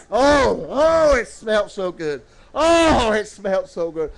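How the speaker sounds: normal talking voice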